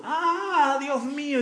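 a high crying wail to God; anguished cry. A narrator's reading. Tamar leaving her brother's house, crying out high in anguish. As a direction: cry out like a shamed girl in grief.

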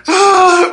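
loud, high-pitched crying wail; mock wailing. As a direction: bawl at full lung, hammed up for laughs. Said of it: "así bien cagazón llorando a todo pulmón". (llanto a todo pulmón, exagerado)

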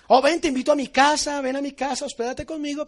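mock eager invitation. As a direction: oversell the invitation, hammed-up. Anfitrión invita con falso entusiasmo. (invitación exagerada y entusiasta)

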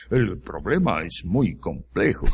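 deep, self-important pundit tone; pompous voice. Retold or imitated. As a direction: say it like a pompous expert. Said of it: "con voz engolada así".

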